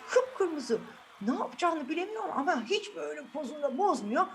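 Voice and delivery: usual speaking voice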